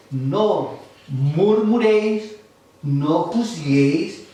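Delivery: drawn-out, deliberate scripture; slow emphasis. Real, not acted. Preacher driving the verse home: slow, weighty. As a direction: lean on each word slowly. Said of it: "la Biblia dice".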